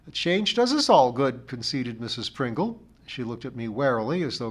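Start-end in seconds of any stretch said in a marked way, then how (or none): 0.1-1.5 raised, louder complainer's voice; opinionated char lady. A narrator's reading. Missus Pringle gives the narrator her views, opinionated. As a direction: hold forth as the village char lady.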